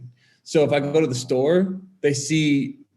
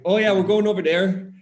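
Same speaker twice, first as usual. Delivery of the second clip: higher, louder Wisconsin fisherman impression; thick Wisconsin accent; 6 semitones higher, 5 dB louder than usual. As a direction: put on a hearty Wisconsin accent. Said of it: "they thick accent".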